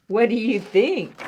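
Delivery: usual speaking voice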